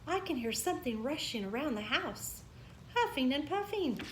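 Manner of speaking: high, anxious little mouse voice; child mouse scared of night noises; worried Little Mouse. Acted. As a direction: whisper a fear to your big brother.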